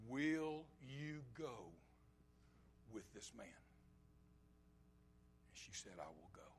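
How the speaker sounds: slow, softly posed question, falling away to very quiet; hushed solemn retelling. Acted. Preacher retells a family asking Rebekah to go, hushed and solemn.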